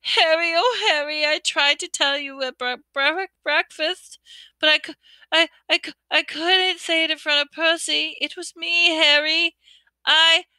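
high, broken, stammering through tears; a frightened girl confessing through tears; tearful sobbing girl. Acted. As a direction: sob it out, tripping over every word. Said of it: "tears began to run".

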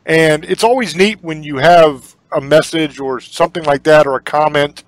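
usual speaking voice

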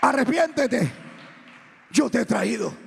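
high, loud retold command; pastor's call to repent. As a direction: cry it out as a prophet to a sinner. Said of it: "dice el pastor así llorando".

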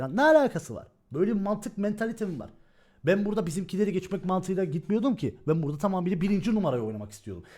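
normal talking voice

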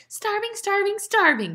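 very high gleeful jeer; shrieking. A narrator's reading. Little Arnie echoes his brother, high shriek. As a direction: shriek it as a taunting chant. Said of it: "shrieked little Arnie".

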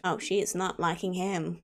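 usual speaking voice

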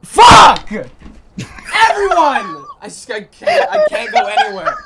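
high startled yelling, laughing; panicked shout. Real, not acted. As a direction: scream then laugh in real fright. Startled by ghost in game.

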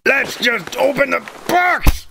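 usual speaking voice

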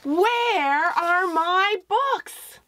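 high, very slow, drawn-out cry; wailing refrain. Acted. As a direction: stretch it out like a lost child calling. The book's title refrain voiced.

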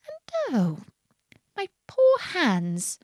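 lamenting. A narrator's reading. Alice laments her lost hands.

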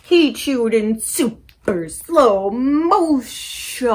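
very slow, stretched words; slow motion voice. Shown as a demonstration. Librarian showing slow motion chewing, very slow.

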